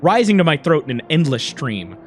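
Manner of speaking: low, contained narration of rage; story narration. A narrator's reading.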